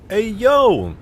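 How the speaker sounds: high, loud streamer shout; hyped greeting. Real, not acted. Streamer greets a donor's super chat, hyped. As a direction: call it out like a celebration.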